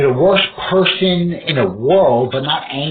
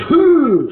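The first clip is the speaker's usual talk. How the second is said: sudden high, loud "hoo" of shock; startled high whoop; mock gasping whoop; 14 semitones higher, 7 dB louder than usual. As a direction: yelp it out like he got hit in the chest. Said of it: "if he goes".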